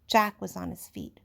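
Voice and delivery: ordinary delivery, nothing marked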